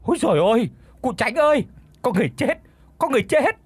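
high-pitched cry of alarm; panicked screaming. A narrator's reading. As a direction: cry out for help in short high bursts. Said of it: "miệng thì gào lên bai bài".

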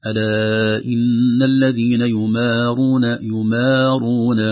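"يمارون" very slow, with a thin, light "maa"; measured recitation, "يمارون" without darkening before the ر; no thickening. Shown as a demonstration. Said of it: "هكذا بدون، آه، تفخيم".

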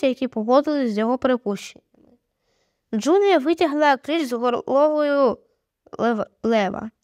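plain reading voice

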